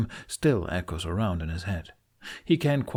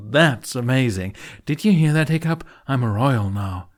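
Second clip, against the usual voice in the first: loud, high delighted call; calling out gleefully; 4 semitones higher, 7 dB louder than usual. Acted. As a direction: shout it across with delight. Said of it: "he calls".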